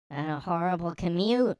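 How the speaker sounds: normal talking voice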